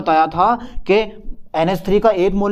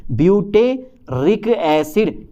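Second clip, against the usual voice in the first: "Butyric acid" drawn out syllable by syllable; very slow "butyric acid", split into its parts; slow dictation; much slower than usual. Shown as a demonstration. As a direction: stretch out "butyric acid" for note-takers. Teacher repeats it slowly for students.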